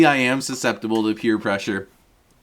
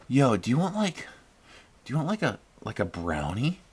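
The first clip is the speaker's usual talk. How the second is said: softer, hushed, lower near the end; hushed offer; 3 semitones lower, 9 dB quieter than usual. Retold or imitated. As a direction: offer it low, almost secretly. Worker quietly offering a brownie.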